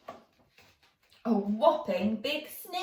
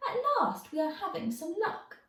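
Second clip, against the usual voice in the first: put-on high, fast happy boy; excited; 9 semitones higher, faster than usual. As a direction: cheer that luck has come. George cheers.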